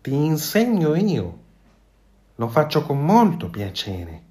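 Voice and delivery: cheerful goose. A narrator's reading. The goose happily offers to teach the fox to swim. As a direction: offer it gladly, beaming. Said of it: "rispose Oka più allegra che mai".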